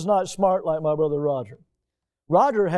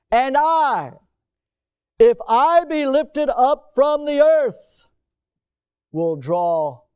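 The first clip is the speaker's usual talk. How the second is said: higher, louder and slow on "if I be lifted up from the earth"; preaching the verse; 6 semitones higher, 4 dB louder, slower than usual. Acted. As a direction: lift the verse up slowly. Quotes verse thirty-two.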